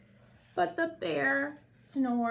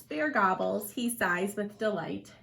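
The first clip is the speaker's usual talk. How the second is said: ordinary delivery, nothing marked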